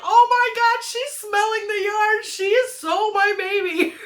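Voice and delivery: very high, loud, delighted shriek; excited scream. Retold or imitated. Aunt re-enacts seeing baby niece smell the yarn, screaming. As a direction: scream it out with drawn-out words, laughing at the end. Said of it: "I screamed".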